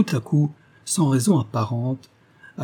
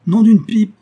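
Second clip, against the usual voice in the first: much higher and louder, blurted in a rage; furious oath; 10 semitones higher, 10 dB louder than usual. A narrator's reading. The exposed fake detective swears at James in a rage. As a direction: spit it out in fury. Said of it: "répéta rageusement Bob Staff".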